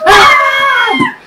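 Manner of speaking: high, loud pretend scream; long hammed-up shriek of dismay; mock horrified scream. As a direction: scream as if it just burst into flames. Pretend scream as armor bursts into flames.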